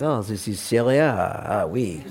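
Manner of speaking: a hammed-up French official saying "Ah, oui"; mock French accent, a Frenchman dismissing the map. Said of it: "Sorry, wrong accent".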